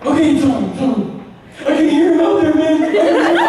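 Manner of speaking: very high, loud desperate friend; hammed-up frantic squeal; mock terror. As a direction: cry it out in comic panic. Panicking friend acted on phone.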